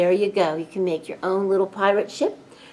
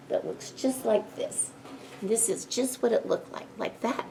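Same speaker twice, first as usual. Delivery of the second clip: whispering; 3 semitones higher, 9 dB quieter than usual. Real, not acted. Showing the flag up close.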